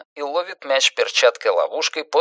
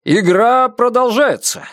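raised-pitch announcer's exclamation; lively commentator calling out; excited exclamation; 10 semitones higher, 3 dB louder, slower than usual. A narrator's reading. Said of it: "воскликнул Джо".

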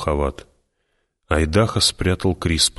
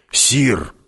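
higher-pitched exclamation of "sir"; startled objection to a superior; exclaimed protest; 7 semitones higher than usual. A narrator's reading. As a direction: call out in protest, pitch jumping up. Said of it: "воскликнул Хават".

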